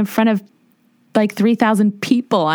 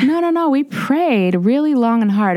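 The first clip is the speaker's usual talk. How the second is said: put-on insistent old ladies; 7 semitones higher than usual. Church grandmas urging her to lead the retreat, mock insistent. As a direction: mimic church ladies gently overruling her, pitched up.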